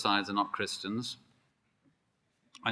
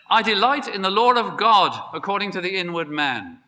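high, loud, ringing delight; exultant declaration; 7 semitones higher, 8 dB louder than usual. Acted. Preacher voicing Paul delighting in God's law, exultant. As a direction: proclaim it with rising joy.

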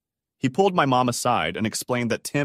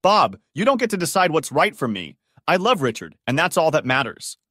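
shouting; 6 semitones higher, 5 dB louder than usual. A narrator's reading. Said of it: "shouted back".

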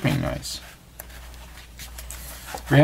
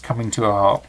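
ordinary delivery

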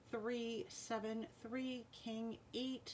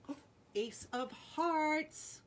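surprised announcement; 8 semitones higher, 4 dB louder, slower than usual. Real, not acted. Drawing the winning card.

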